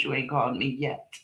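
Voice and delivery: ordinary talk, nothing marked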